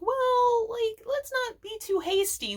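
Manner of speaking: put-on high, sing-song girl voice; a bright kid voice, talking her brother down; Mabel voice. Mabel calms Dipper about Bill, in a high girlish voice.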